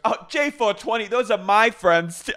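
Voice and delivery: put-on high, loud girlish gushing; mock Angelina gush. Host mimics Angelina claiming the friends, high and loud. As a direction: squeal it like a thrilled reality star. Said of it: "all of a sudden Angelina goes".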